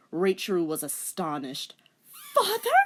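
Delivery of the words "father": high loud shocked yelp. A narrator's reading. Daughter seeing her dead father, shocked yelp. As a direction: yelp it in disbelief. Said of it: "she yelped".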